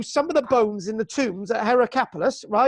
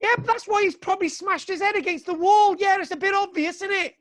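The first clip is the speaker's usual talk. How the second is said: exasperated; 8 semitones higher than usual. Real, not acted. Teacher exasperated by pupil's question.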